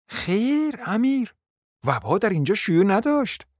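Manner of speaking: a hint of a genteel lady; lady of the house. A narrator's reading. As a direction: correct the prince politely. Narrator voices Maria.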